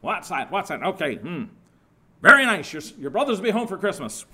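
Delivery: a hint of a bored cigar-chomping editor, then a loud mock-impressed outburst; dismissive editor skimming a telegram; gruff editor. Editor brushing off the sister, lightly voiced. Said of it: "Go away, you bother me kind of people".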